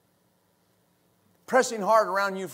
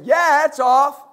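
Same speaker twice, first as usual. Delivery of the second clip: louder, higher shouted reply; calling back; 4 semitones higher, 6 dB louder, slower than usual. Retold or imitated. Coworkers answering Ron in the attic, calling back. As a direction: imitate a coworker hollering back up.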